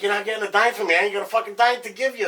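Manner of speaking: retold shouting match with a pushy caller; heated retort. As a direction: tell the scammer off, half laughing. Argument with scam caller, retold.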